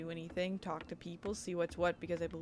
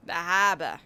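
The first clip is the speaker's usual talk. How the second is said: The harbor as Boston "hah-buh"; Boston accent; 9 dB louder, slower than usual. Retold or imitated. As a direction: drop the r in harbor, Boston style. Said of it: "I get a weird Boston accent".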